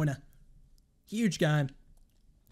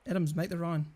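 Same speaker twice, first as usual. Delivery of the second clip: ordinary talk, nothing marked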